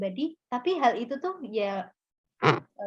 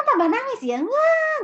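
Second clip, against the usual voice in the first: mock wail; 14 semitones higher, 7 dB louder, slower than usual. Mimicking crying harder.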